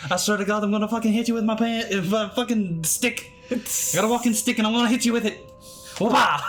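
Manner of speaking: high, fast hammed-up old man rant; angry threat. Supervisor threatening the agent with a walking stick, mock fury. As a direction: threaten to whack him with a stick.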